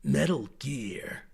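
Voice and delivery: slow low gravelly Snake; lightly voiced Snake grunt; Snake impression. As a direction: growl it like Solid Snake. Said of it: "Snake is like".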